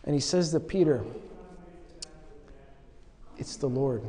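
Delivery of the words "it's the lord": soft, hushed and much quieter, kindly telling; gentle and quiet. Retold or imitated. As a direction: lean in and tell Peter tenderly. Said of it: "very gently, very charitably".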